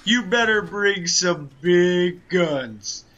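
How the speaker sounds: loud, brash wrestler promo voice; boastful wrestling villain, shouting; Ric Flair impression. Nature Boy voice continues.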